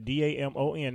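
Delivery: D A M O N spelled letter by letter. Shown as a demonstration. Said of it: "put my first name in".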